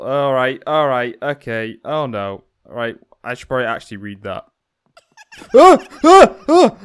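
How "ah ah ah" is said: short, high, loud shrieks; startled screams. Real, not acted. Player jump-scared by the doll, shrieking. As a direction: scream in repeated bursts of fright.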